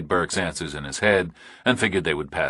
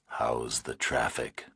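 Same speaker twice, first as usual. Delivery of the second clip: slow, low, menacing whisper; distorted nightmare voice; 6 semitones lower, 9 dB quieter, slower than usual. A narrator's reading. Kidnapper on the phone to Burke, slow eerie whisper. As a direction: breathe it like a monster on the line.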